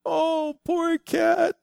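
high, pitying little voice; imitating Jessica's pity. Father voicing his daughter's pity for a dead cat on the road. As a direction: coo it out like a tender-hearted girl. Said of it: "Jessica's pointed it out".

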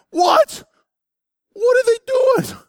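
high, loud indignant "What? What are they doing?"; outraged cry. Retold or imitated. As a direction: cry out like a cheated reader. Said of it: "I was so angry".

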